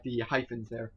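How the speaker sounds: ordinary talk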